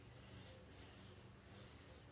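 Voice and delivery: a soft snake hiss. Shown as a demonstration. Teacher showing kids the snake sound, a hiss. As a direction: breathe out "S" like a snake. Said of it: "do the sound and signal".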